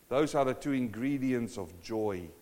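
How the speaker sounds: ordinary delivery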